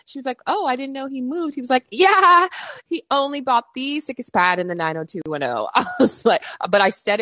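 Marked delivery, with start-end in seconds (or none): 0.5-1.5 high, soft, airy reply; airy surprise. Retold or imitated. 1.9-5.7 bragging. Retold or imitated. Bragging about the pad.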